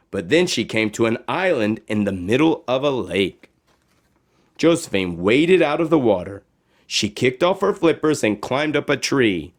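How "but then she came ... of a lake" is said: usual speaking voice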